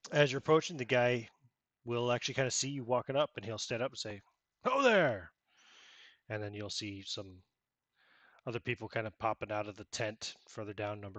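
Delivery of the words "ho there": loud hail to a stranger; calling out. Acted. Guard hailing a stranger walking up, loud call. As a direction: call out to the figure approaching.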